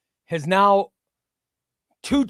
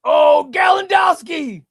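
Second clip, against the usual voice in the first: drawn-out shout, much higher and louder; dramatic callout; 9 semitones higher, 9 dB louder, slower than usual. Real, not acted. Streamer announcing a chat member's elimination, dramatic drawn-out shout. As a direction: belt the name out like a game show host.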